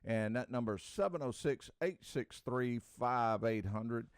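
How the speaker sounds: high, punchy welcome back; energetic on-air reintroduction; radio host intro. Real, not acted. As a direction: announce the show and its number, settling as you go. Host reopens show after break.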